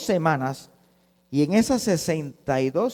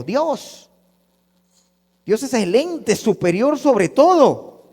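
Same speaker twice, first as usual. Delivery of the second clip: ordinary talk, nothing marked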